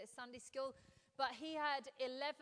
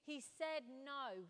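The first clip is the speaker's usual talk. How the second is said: "no" drawn out slowly and firmly; slow down and land on "no"; emphatic NO; slower than usual. Real, not acted. Preacher telling of Joseph's refusal, emphatic "no". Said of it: "no with a capital N".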